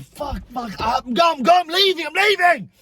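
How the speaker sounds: worked-up retold rant, climbing in pitch; raging. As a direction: act out losing it, building to a high yell. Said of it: "I start raging".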